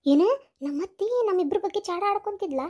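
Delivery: ordinary delivery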